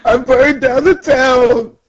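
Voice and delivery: high, slow wail; hammed-up high weeping; mock crying. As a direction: confess in a drawn-out pretend sob. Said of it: "he's crying".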